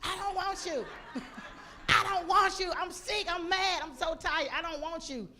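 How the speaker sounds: hammed-up crying fit; screaming, crying tantrum. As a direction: wail it like an overtired toddler. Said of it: "Screaming, crying, like".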